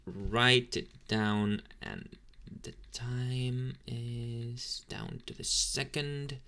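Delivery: slow, drawn-out words as if noting them; talking while writing. Acted. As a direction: stretch each word while jotting it down.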